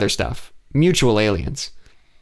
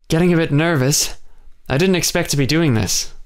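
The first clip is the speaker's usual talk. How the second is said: nervous Jonathan; 6 semitones higher than usual. A narrator's reading. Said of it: "he said and chuckled".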